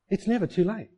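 ordinary talk

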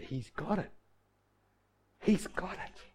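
hushed, soft, repeated to himself; acted quiet assurance, well under normal volume; quiet realization. [name] smiles at the uncle's faith, quietly assured.

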